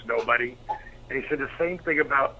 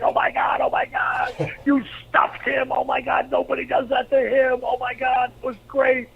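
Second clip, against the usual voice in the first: high-pitched, excited impression of a friend; imitated giddy amazement; astonished gushing; 10 semitones higher than usual. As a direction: gush in disbelief, pitched way up. Big Show reacts, acted out.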